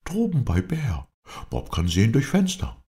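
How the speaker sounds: high, lively character voice; Bob, eager helper. A narrator's reading. Bob tells his master how he found the tree, high and lively.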